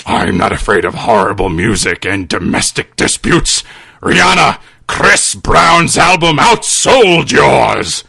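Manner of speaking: necromancer mocking the old man's summons; low villain taunt. Necromancer replies.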